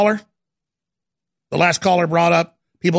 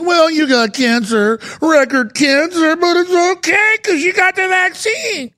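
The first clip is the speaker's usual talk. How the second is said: higher, slowed, sneering parody of the officials; mock reassurance; 9 semitones higher, slower than usual. Parodying officials telling the sick it's fine, slow and high-pitched. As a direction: drag it out in a high, syrupy mock-soothing voice. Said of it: "And now it's like".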